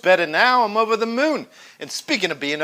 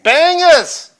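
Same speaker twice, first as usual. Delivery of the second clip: bangers yelled, much louder and higher; mock shout; 7 semitones higher, 9 dB louder than usual. Cohost shouts a food pun over the host. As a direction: blurt it out gleefully.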